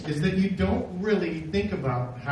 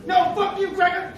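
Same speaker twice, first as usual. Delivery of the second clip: angry heckler; 11 semitones higher, 4 dB louder than usual. Retold or imitated. The heckler shouts back at him, high and mocking. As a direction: spit it out like a heckler.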